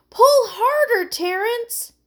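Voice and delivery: put-on high, loud girl's plea; urgent call. A narrator's reading. Heidi to Terrence on shore, urgent call. Heidi urging from stuck boat.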